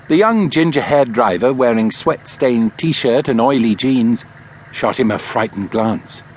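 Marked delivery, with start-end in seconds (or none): none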